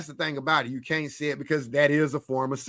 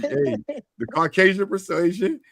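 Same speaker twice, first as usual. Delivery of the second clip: normal talking voice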